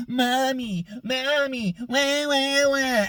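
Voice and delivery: high whiny baby wail, hammed-up; mock crying kid. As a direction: imitate a bawling child to mock him.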